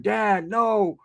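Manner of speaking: retold childish plea; scared kid. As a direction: imitate a frightened little boy begging. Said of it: "I'm scared to death".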